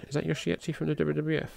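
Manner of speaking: usual speaking voice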